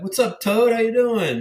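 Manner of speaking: high, loud, beaming hello; loud hearty dad hello, pitched high; dad's hearty greeting. Retold or imitated. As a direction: greet him like a proud dad. Dad greeting son warmly.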